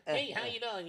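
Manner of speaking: a squeezed, pressed mayor-puppet hello; mayor shave voice. Shown as a demonstration. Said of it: "So it's like".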